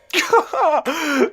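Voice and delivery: high, delighted burst of laughter; gleeful laughter; joyful laugh. Acted. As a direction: laugh with relief and joy. Laughing at the success.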